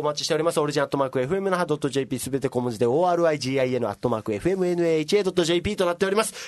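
rapid, one breath without pausing; all in one breath. Real, not acted. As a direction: rattle off the address fast. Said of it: "よし、一息で言えた。".

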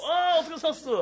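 loud, high, laughing welcome; excited greeting. Real, not acted. Host greeting an arriving guest, loud and laughing.

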